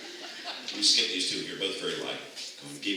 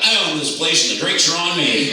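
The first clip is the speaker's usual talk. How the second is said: shout; 4 semitones higher, 7 dB louder than usual. Shown as a demonstration. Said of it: "in your loudest voice and shout out".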